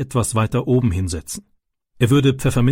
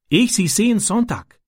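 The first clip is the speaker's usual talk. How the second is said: put-on lightly raised, shy boy's voice in broken German; a hint of a young foreign boy telling his worry; Ram's childlike broken German; 9 semitones higher than usual. Ram answers Francis.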